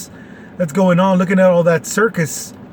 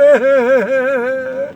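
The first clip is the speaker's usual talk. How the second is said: amused laugh; 6 semitones higher than usual. Real, not acted. Laughs at his own parody.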